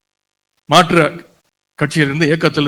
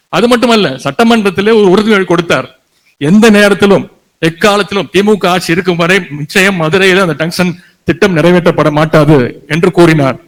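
thunderous political oratory; 4 semitones higher, 6 dB louder than usual. Acted. Rally speech climax.

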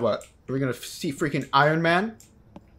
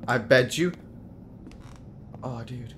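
normal talking voice

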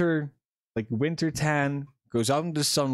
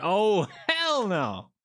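drawn-out "hell no", swooping up high; playfully exaggerated, slow refusal; mock refusal; 5 semitones higher, much slower than usual. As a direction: imitate an emphatic refusal, stretched right out. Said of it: "Yeah, he went".

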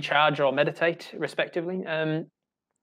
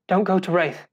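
higher, louder warning; a light character voice, pitched up; shaken stranger; 4 semitones higher, 4 dB louder than usual. Acted. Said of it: "this person turns to you and says".

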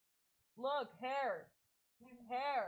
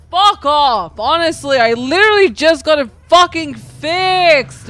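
loud, heated complaint; angry rant; 4 semitones higher, 11 dB louder than usual. Real, not acted. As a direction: rant loudly at the damage. Car just repaired, rage.

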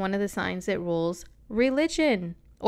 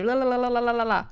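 rapid la-la babble, slightly raised; mock fast talking; 4 semitones higher, faster than usual. Shown as a demonstration. Showing viewers how fast they would talk, rapid babble. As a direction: rattle syllables off without a break. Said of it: "you're going to be talking so fast".

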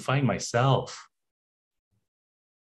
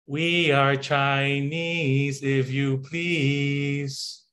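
slow, exaggerated mock Chinese accent; 2 semitones higher, much slower than usual. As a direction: drag it out in a caricatured Chinese accent. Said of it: "Here's why".

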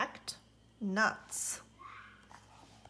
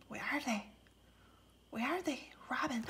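hushed; 9 dB quieter, much faster than usual. Acted. Searching quietly for birds.

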